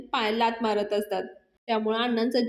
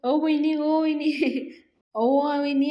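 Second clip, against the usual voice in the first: loud call, breaking into a laugh; hammed-up yell for help; calling out loudly; 4 semitones higher, slower than usual. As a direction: shout across the yard to sister-in-law. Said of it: "ओरडायला लागतो मोठ्याने".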